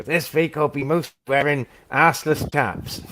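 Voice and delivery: slow, high West Country yokel; Fast Show character voice. Retold or imitated. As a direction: drawl it like a comedy sketch yokel. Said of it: "It's like mate, matey from, uh, Fast Show".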